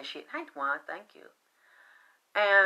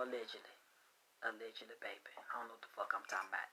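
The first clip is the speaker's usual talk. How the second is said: whisper; 9 semitones lower, 19 dB quieter, much faster than usual. Real, not acted. Whispered aside after wild claims.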